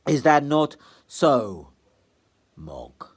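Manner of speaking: cold villain. Acted. Trite coldly tells Meg the poor should eat bones. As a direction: savour every cruel word.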